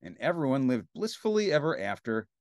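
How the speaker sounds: ordinary delivery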